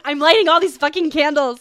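loud, laughing mock-frantic outburst; loud laughing outburst about the candles; laughing exasperated exclaim. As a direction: blurt it out mid-laugh. Retelling lighting birthday candles, laughing.

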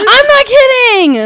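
very high, drawn-out loud wail; emphatic shout. Real, not acted. Storyteller insists to a disbelieving friend, high slow shout. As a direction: shriek it in comic insistence.

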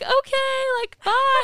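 very high, loud phone sign-off; mock eager goodbye. Agent trying to hang up, high loud mock goodbye. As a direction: squeak out a hurried-to-leave goodbye, hammed up. Retold attempt to end the call.

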